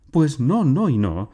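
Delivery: loud, high, emphatic no; indignant refusal. A narrator's reading. Aunt overruling uncle's talk of free choice, indignant. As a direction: slam the door on it with each no.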